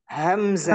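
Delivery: همزة with a clear glottal stop; hamza corrected. Shown as a demonstration. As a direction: repeat همزة with the catch at the start. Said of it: "أحسن". (نطق صحيح للهمزة)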